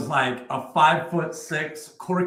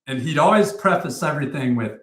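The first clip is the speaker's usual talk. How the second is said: ordinary talk, nothing marked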